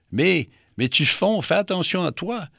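high, urgent worried voice; alarmed warning. Acted. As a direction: warn her she is melting. Vénus s'alarme pour la lune.

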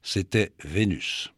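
usual speaking voice

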